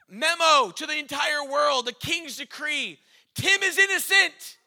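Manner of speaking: high, loud herald's proclamation; royal decree. Acted.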